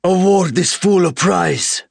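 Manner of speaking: high, loud, slow alien voice full of disgust. Acted. Alien with the launcher to his brothers: high, slow, disgusted. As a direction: spit the words out in contempt. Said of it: "said in disgust".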